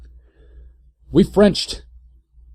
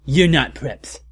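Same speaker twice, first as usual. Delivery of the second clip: mock rant; 6 semitones higher, 3 dB louder than usual. Author's note ranted aloud.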